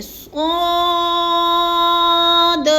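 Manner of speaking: स्वाद chanted high and slow, heavy, with a bounce on the final द; recitation with qalqala. Shown as a demonstration. Teacher demonstrating qalqala on the dal. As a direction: stretch it and jolt the दाल. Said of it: "थोड़ा सा हिलाना भी है दाल को".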